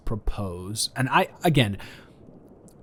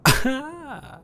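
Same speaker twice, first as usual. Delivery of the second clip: high, bashful burst of laughter; embarrassed laugh. Real, not acted. Boyfriend laughing at his own awkward slip, embarrassed giggle. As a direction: laugh it off, a little flustered.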